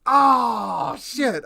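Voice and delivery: loud high shocked outburst. Real, not acted. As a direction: blurt it in disbelief. Reacts to needing natural twenty.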